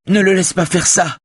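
very high, panicked plea; acted terrified begging; desperate cry. A narrator's reading. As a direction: cry out frantically in a high female voice. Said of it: "s'écrie-t-elle".

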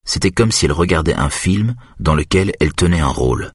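ordinary delivery

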